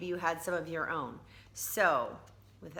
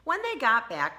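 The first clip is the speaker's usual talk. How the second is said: usual speaking voice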